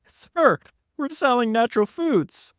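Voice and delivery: very high, acted-out protest; exasperated protest. Acted argument over the store.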